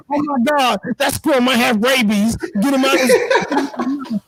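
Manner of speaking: high, alarmed wife voice, nagging; worried wife. Retold or imitated. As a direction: mimic the alarmed wife in his ear. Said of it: "here she go in the back of my ear".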